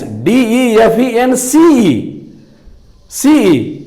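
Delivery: letters डी ई एफ ई एन सी ई spelled very slowly; slow letter-by-letter dictation; spelling out slowly. Shown as a demonstration. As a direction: spell each letter apart. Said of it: "defence".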